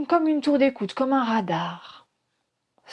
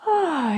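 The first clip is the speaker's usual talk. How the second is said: long exhaling sigh through the mouth; demonstrated sigh; 9 semitones higher than usual. Shown as a demonstration. Teacher shows listeners how to breathe out, audible sigh. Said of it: "soupirez par la bouche".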